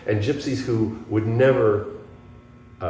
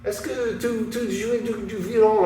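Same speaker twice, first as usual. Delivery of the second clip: much higher, eager French voice; French guitarist calling out; 12 semitones higher, slower than usual. Retold or imitated. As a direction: hail the stranger in a high, excited French voice. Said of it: "he points at me, and he goes".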